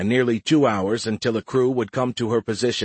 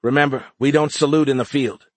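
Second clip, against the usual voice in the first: louder, higher mild reproof; admonishing; 5 semitones higher, 4 dB louder than usual. A narrator's reading. Soldier reminding alien liaison about saluting, admonishing. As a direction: remind the rookie of the rule. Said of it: "Shauna admonished their young alien liaison".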